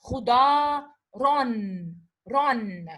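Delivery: khuda loud, then ron, ron falling; syllable by syllable. Shown as a demonstration. Teacher spelling out the tanween for the class: syllable by syllable. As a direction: break khuda, ron into beats. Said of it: "Because I made this tanween".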